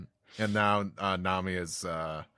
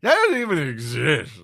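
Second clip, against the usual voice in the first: put-on loud, much higher indignant drunk; drunk Usopp; 7 semitones higher, 12 dB louder than usual. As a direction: blurt it out offended. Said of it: "He says".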